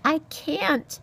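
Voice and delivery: high, distressed little voice; mouse's cry. A narrator's reading. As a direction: cry out helplessly as a small mouse. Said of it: "Poppy cried".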